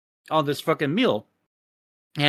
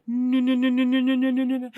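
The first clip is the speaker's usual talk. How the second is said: higher-pitched, rapid string of no's; mock inner voice; 8 semitones higher, faster than usual. Server refusing to shortchange the burned customer, mock high voice.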